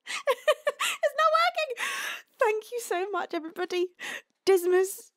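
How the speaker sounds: high-pitched tearful squeak; breaking into tears. Real, not acted. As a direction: lose the fight against real crying. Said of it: "I'm trying not to cry".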